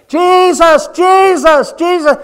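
high, loud repeated yell. Acted. Beggar yelling over the crowd for Jesus, high and loud. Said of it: "the louder he got and the more he hollered".

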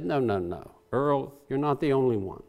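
soft, low, kindly reassurance; gentle God. Retold or imitated. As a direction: hush him like a kind father. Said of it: "God's gentleness".

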